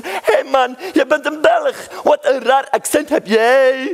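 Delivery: high, loud mock rapper call. Comedian imitating a rapper hailing him, loud and high. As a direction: shout it like a street rapper. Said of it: "Zo'n rapper".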